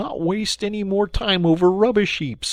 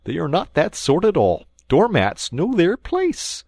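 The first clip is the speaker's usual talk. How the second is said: higher, louder peevish voice; sulky and dismissive; petulant; 11 semitones higher, 6 dB louder than usual. Acted. Mole pettishly dismissing Rat's folly. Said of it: "said the Mole quite pettishly".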